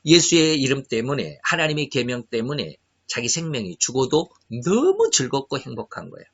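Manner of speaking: normal talking voice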